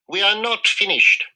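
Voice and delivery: a small, worried mouse doctor; voiced mouse dentist. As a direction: play the little dentist, doubtful.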